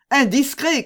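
high, loud exclamation of reproach; indignant cry. A narrator's reading. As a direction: cry out a scolding. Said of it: "cria d'Artagnan".

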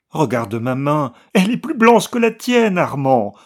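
a hint of hysterical laughter in a loud high cry. A narrator's reading. Wife showing her husband her hand, mildly hysterical cry. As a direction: push it to the edge of hysteria. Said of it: "avec un rire hystérique".

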